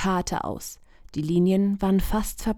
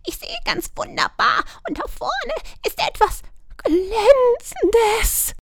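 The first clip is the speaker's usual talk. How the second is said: very high, thrilled squawk; excited magpie; 3 dB louder than usual. A narrator's reading. Magpie Vika spotting something shiny, squawking high. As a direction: squawk with delight at the shine. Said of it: "flatterte aufgeregt".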